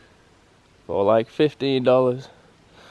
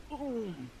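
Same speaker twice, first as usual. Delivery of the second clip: excited; 9 semitones higher, 22 dB quieter, much slower than usual. Real, not acted. Fish bites, sudden hushed excitement.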